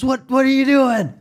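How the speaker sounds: high loud yell at the helicopter. Retold or imitated. Bruce Willis yelling up at the helicopter, loud high shout. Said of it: "he yells at the helicopter".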